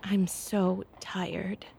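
whispered, slow and weary; tired whisper. Acted. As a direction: breathe it out exhausted. Exhausted wife confides quietly.

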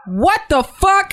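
high, slow, drawn-out outrage; stunned disbelief. Retold or imitated. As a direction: let the shock stretch every word. Shocked at stranger's crude remark.

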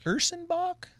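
Ursenbach very high, rising like a question; unsure pronunciation. Real, not acted. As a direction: Ursenbach said with doubtful rising tune. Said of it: "Am I saying that right?".

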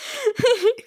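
high giggle; laugh. Real, not acted. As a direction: giggle brightly. Author laughs at own joke.

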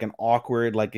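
ordinary delivery

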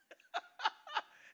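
high breathy burst of laughter. Real, not acted. Preacher laughing at his punchline, high breathy laugh.

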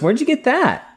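high questioning voice. Acted. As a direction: ask it high, as a startled character. Rand questions Mat, acted.

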